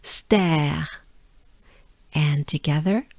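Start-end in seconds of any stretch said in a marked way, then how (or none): 0.0-0.9 stare drawn out in French pronunciation; French-accented stare, breathed R at the end. Shown as a demonstration. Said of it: "that's what we're looking for".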